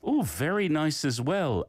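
ordinary delivery

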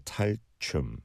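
normal talking voice

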